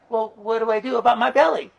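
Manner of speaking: mock worried professor. Karl asking shaman.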